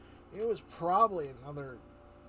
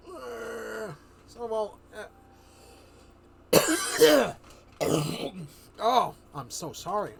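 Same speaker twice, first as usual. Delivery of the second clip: normal talking voice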